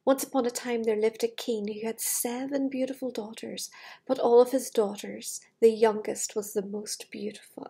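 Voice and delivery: storybook reading voice; story narration. Acted. Amanda reading the fairy tale to children, in storybook voice. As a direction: read it like bedtime for little ones.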